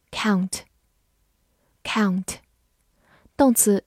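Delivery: ordinary delivery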